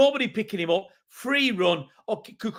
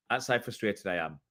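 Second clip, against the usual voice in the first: frustrated and deflated; 8 semitones lower, 8 dB quieter than usual. Real, not acted.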